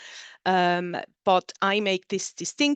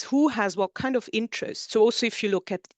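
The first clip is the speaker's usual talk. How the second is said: usual speaking voice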